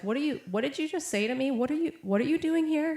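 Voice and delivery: high-pitched, earnest questioning of strangers; retold earnest demand, pitch climbing. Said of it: "just very earnest".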